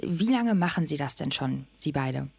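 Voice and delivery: normal talking voice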